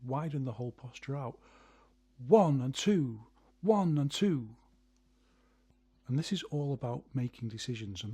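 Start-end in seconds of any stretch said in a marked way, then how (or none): 2.3-4.5 One and two both hit loud; emphasis on both beats. Shown as a demonstration. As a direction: punch One and two alike. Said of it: "put more emphasis on both".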